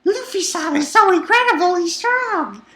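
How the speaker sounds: high, loud squeal of admiration; high, loud adoring princess squeal; gushing princess voice. Retold or imitated. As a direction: gush like a starry-eyed princess. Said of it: "Shiroshi's like".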